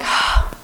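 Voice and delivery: acted breathy sigh to collect oneself; audible sigh. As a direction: let out a short sigh. Retold collecting herself after crying.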